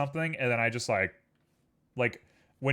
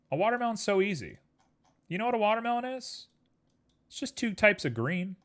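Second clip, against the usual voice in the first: higher, giddy and bouncy; excited; 8 semitones higher than usual. Real, not acted. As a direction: rattle it off with giddy glee. High excited explanation.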